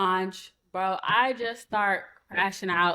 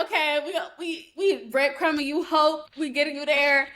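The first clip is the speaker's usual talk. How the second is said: higher, lifted acted optimism; mock hopefulness; 6 semitones higher than usual. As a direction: dangle hope in a raised voice. Song's mood retold as hopeful.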